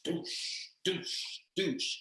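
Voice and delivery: soft whooshing thud sounds; quiet repeated impact noises; falling-body thuds. Retold or imitated. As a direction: imitate bodies dropping, hushed. Imitates bodies falling from trees.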